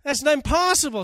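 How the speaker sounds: a high, loud, disbelieving outburst; incredulous Abraham. Acted. Abraham reacting to God's promise, in loud, high disbelief. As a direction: toss it up in stunned disbelief. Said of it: "and Abraham says".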